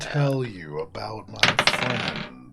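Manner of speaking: very slow, low, flat monotone; Ben Stein drone. Pretend. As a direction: drag every word out like a bored lecturer. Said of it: "Just go straight Ben Stein".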